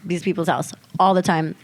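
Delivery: ordinary talk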